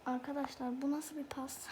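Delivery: hushed, incredulous whisper; stunned whisper to viewers. Real, not acted. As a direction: lean in and whisper in disbelief. Whispering disbelief at a pass.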